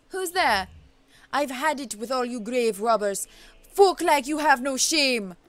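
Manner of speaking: loud, indignant witch doctor, mock Jamaican; attempted Jamaican accent. Witch doctor confronts grave robbers, attempted Jamaican accent. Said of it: "shoot for a Jamaican accent".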